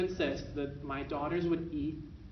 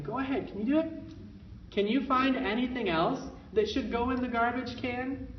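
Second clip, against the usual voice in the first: higher, coaxing, upbeat; encouraging parent voice; 6 semitones higher than usual. Shown as a demonstration. Parent guiding toddler to throw trash away, encouraging. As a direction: lead the toddler along cheerfully. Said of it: "Parent says".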